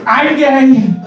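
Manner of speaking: hammed-up proud declaration as Trump; mock Trump coming out. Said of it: "first thing he says".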